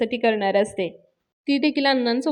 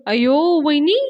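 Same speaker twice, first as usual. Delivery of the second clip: mock-dismayed cry, rising at the end; alarmed retelling; 3 semitones higher, 5 dB louder than usual. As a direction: exclaim in comic worry. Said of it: "धन्याला tension येतं". (घाबरल्याची नक्कल करत)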